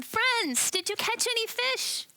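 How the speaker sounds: high, loud call across the water. Acted. Stranger on the shore calls out to the fishermen. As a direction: shout it out to the boat from shore. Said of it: "he yells out at them".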